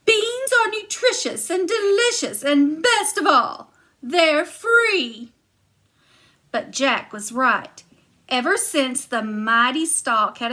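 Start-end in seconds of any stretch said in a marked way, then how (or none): 0.0-5.3 high, louder huffy giantess. Acted. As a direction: brush it off, pitched up. Giantess praises beans.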